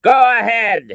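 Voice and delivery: high, loud defiant call; yelling. A narrator's reading. Father calls the colonel's bluff, yelling. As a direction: yell it as a dare.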